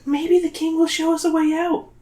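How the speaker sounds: very high, thin companion voice; character voice. A narrator's reading. Reader voicing a line to Zoe, high put-on voice. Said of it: "I did the wrong voice".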